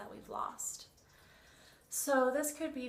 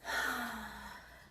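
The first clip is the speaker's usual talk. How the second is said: long, airy sigh out through the mouth; deep exhale. Shown as a demonstration. Instructor demonstrating deep breaths to listeners, long exhaled sigh. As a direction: let out a slow cleansing breath. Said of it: "breathe out of the mouth".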